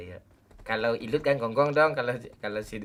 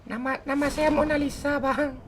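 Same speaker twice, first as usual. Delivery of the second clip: put-on high girly falsetto voice; sassy drag queen; 14 semitones higher than usual. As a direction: squeak it like a camp girl. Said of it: "pakai suara kecil tuh yang cewek".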